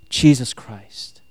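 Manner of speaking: hushed end to a proclamation; reverent drop. Acted. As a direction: let the name land slowly and quietly. Hushed close of God's declaration.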